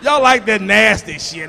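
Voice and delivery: normal talking voice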